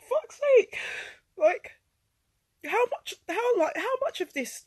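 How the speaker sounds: high, loud, overwhelmed distress; real anguish. Real, not acted. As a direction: break out in despair as you relive terrible news. Reliving being told son will die.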